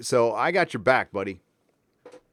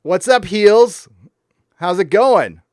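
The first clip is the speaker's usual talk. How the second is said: loud excited greeting; 5 semitones higher, 8 dB louder, slower than usual. Real, not acted. Greeting a late-night viewer.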